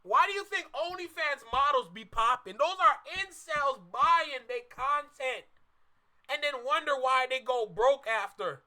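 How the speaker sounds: ordinary talk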